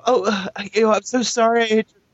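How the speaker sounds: high, fluttery imitation of a frantic apology; imitating a flustered woman who just hit a car, high and gushing; mock apologetic driver. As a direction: pitch way up and gush sorry like the panicked driver. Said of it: "she's all".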